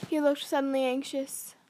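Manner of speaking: ordinary delivery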